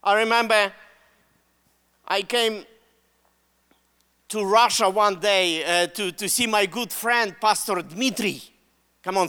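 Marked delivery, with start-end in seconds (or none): none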